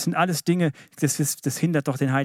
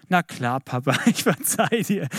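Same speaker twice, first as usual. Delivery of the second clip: 1 voicing his children. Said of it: "wenn meine Kinder sagen".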